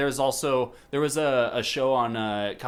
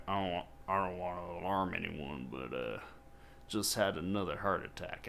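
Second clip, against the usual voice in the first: deadpan Cheney; 5 semitones lower, 11 dB quieter than usual. Retold or imitated. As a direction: lower and much softer, casual understatement. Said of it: "he's just like".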